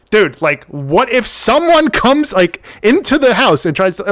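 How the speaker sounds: high, slow, drawn-out worry; a hammed-up panicked duo partner; mock alarmed plea. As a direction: stretch it out like a nervous teammate. Retelling pleading with partner.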